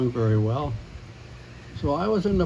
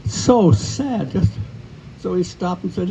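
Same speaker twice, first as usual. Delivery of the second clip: normal talking voice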